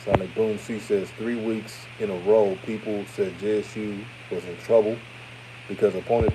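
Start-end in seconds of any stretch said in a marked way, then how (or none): none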